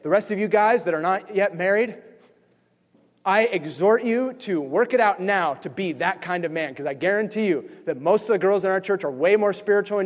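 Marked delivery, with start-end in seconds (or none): none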